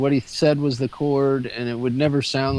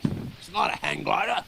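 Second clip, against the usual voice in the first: mock indignant, softer retort; touchy friend; 4 semitones higher, 6 dB quieter than usual. Mocking a friend who insists it's not a hang glider. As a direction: imitate his huffy denial. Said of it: "He did not like that".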